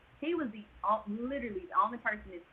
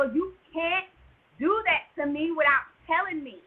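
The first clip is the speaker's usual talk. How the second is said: raised, louder retold objection; angry protest; 7 semitones higher, 5 dB louder than usual. Patient to doctor who broke her water, angry protest. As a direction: indignantly tell someone off.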